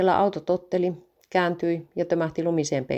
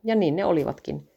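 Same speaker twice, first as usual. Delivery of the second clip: ordinary delivery, nothing marked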